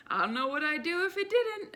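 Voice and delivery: high, loud chuckling joke; joking old lady. Retold or imitated. Old lady joking to the cashier and queue, high and loud. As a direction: play it up for the line.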